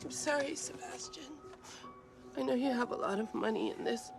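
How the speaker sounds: low, hushed apology; regretful. Acted. Actress apologizing to Sebastian for quitting, regretful. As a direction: let it out softly and sadly.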